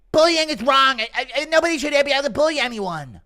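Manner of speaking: high, loud, squeaky outraged voice; Mikey Musumeci impression. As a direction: shout it way up high like a bullied victim. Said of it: "Impression of Mikey Musumeci".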